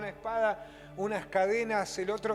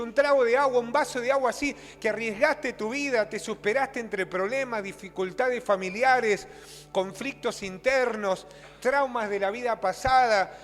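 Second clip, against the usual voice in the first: loud, driving preacher's exhortation; predicación enérgica; 3 semitones higher, 7 dB louder than usual. Real, not acted. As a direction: build point on point at full preaching force. Predica con toda su fuerza. (prédica fuerte y apasionada)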